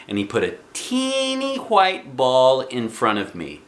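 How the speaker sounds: usual speaking voice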